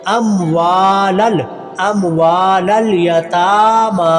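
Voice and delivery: अमवालल यतामा recited slowly, waa, taa and maa held; lengthened vowels. Shown as a demonstration. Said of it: "वाव को लंबा करेंगे". (अमवालल यतामा में वा, ता, मा लंबा)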